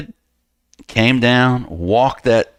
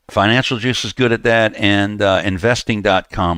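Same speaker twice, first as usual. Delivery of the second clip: usual speaking voice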